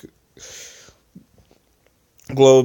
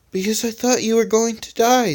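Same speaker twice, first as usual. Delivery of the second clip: Rubber's voice; 10 semitones higher than usual. Acted.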